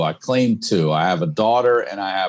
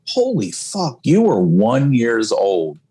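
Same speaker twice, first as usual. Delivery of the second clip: retold amazement; slower than usual. Dad looking at old photos of his daughter, retold amazement. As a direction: marvel slowly at the realisation.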